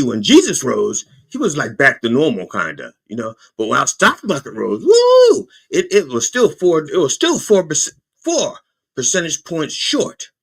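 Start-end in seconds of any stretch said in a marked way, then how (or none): 4.8-5.5 a high, loud mock whoop; mock cheer. Comic whoop at stocks bouncing back partway. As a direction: whoop like the crowd when stocks jump.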